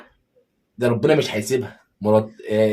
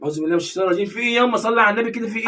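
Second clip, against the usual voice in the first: much higher, louder whining son; whiny son; 10 semitones higher, 3 dB louder, faster than usual. Acted. Son to mother barred from home, high whiny plea. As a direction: whine at mom like a spoiled boy.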